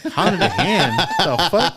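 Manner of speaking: high, loud, laughing mock disbelief. To his wife about the hotel, incredulous laughing scoff. Mocking the wife's hotel request.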